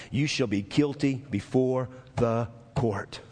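measured, low delivery with GUILTY set apart; slow, weighty reading. Real, not acted. Preacher reading the verdict to the church, slow and weighty. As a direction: spell out the verdict slowly, word by word. Scripture line slowed for weight.

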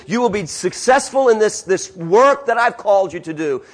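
normal talking voice

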